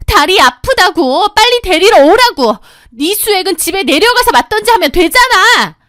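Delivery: very high, loud whining; shrill whiny demand. Acted. Sister-in-law demanding pickup, shrill and whiny. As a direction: whine and yell at once.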